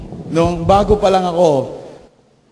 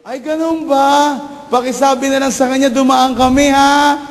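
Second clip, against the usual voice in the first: sweet visiting voice; 7 semitones higher, 4 dB louder than usual. Retold or imitated. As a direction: coo it at the child. Visitor speaking sweetly at door. (matamis at mataas na boses ng bisita)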